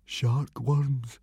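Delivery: nervous gulped words; frightened boy answering teacher. A narrator's reading. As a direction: swallow hard then say it. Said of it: "gulped Fishlegs".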